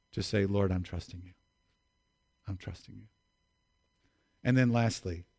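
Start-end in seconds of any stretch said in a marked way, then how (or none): 0.5-3.1 low, hushed words of trust; soft, lowered voice of surrender, fading away; quiet prayer. Acted. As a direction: pray it softly, almost to yourself. Models a trusting prayer to God.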